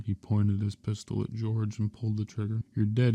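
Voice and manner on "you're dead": plain reading voice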